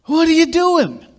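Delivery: high, loud imitation of an exasperated wife; wife catching husband doing the wrong thing, mock exasperated shout. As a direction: play the wife calling him out, half-joking. Acting a spat with his wife.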